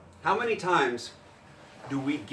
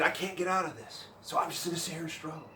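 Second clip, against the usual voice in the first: defeated beginner; 4 semitones higher, 5 dB quieter than usual. Retold or imitated. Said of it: "he's got all kinds of stuff".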